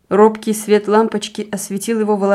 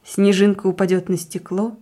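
ordinary delivery, nothing marked

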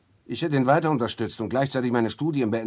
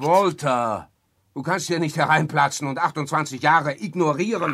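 higher and louder, accusing; angry confrontation; 4 semitones higher, 7 dB louder than usual. Acted. Victor confronting Walter about twenty-eight years, angry and loud. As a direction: hold him to account.

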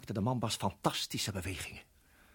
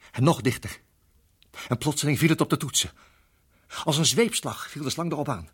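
louder and higher, sudden; climactic outburst; 4 semitones higher, 7 dB louder than usual. Acted. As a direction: let it crash down. Klimaks, slang valt aan. (luide climax)

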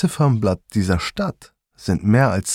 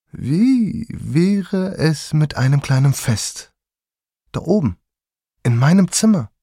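put-on young man's come-on; 7 semitones higher than usual. Young man invites the narrator up to his room, luring. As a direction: coax the stranger up to the room. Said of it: "von einem jungen Mann angesprochen".

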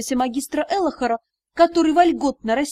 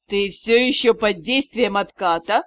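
suspicious; 5 semitones lower than usual. Acted. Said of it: "подозрительно полюбопытствовал".

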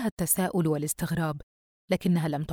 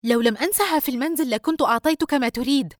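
higher, louder, angry retort; 7 semitones higher, 5 dB louder, faster than usual. A narrator's reading. Sister answering brother's demand for money, angry. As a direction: snap back in exasperation. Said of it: "يسرى بغضب".

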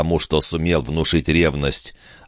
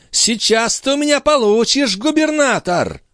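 furious countess. A narrator's reading. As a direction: threaten in a high, angry lady's voice. Countess grabs whip, threatens servant.

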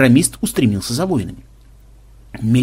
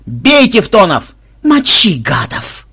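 battle cry; 6 semitones higher, 6 dB louder, slower than usual. Acted. Боевой клич игроков.

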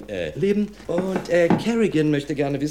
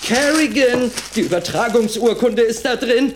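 panicked call; 8 semitones higher, 6 dB louder than usual. Acted. Lawyer calls out in panic.

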